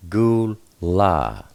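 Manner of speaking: Gul, La split into two held syllables; sounded out syllables. Shown as a demonstration. Teacher spelling out the Hebrew for bowl, syllable by syllable. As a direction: blend the letters slowly into Gul, then La. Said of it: "is pronounced Ah".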